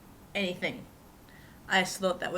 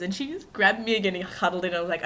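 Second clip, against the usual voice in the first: ordinary talk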